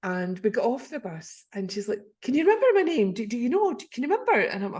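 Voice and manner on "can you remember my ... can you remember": high, pressing retold questions; insistent questioning. Woman pressing an acquaintance for her name, insistently. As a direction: press them repeatedly, pitched well up.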